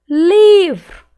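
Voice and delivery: "Livro" with a very loud, high, long LI; stretched stressed syllable. Shown as a demonstration. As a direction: call out "Livro", holding LI. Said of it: "Qual que eu demorei mais falar".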